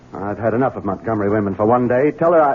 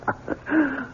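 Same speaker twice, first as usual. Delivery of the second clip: a high, soft burst of laughter; short incredulous chuckle; relieved laugh; 8 dB quieter than usual. Acted. Husband hears the pregnancy was fake.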